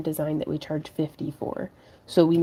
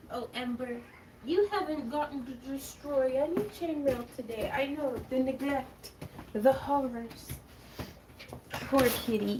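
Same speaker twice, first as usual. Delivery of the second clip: high, soft pet-talk voice; 6 semitones higher, 9 dB quieter than usual. Real, not acted. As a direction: coo pityingly at the cat. Talking to cat Ember.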